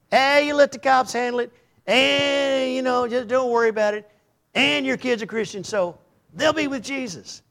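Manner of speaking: mock dismissive voice. Mocking voiced opponent.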